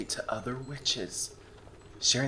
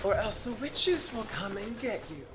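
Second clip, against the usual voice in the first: quoting grandma; 10 semitones higher than usual. Retold or imitated. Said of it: "She'd always say".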